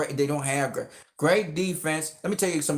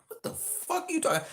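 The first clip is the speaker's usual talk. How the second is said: much higher, softer squeal of disbelief; mock outraged retort; 13 semitones higher, 6 dB quieter than usual. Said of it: "I'm like".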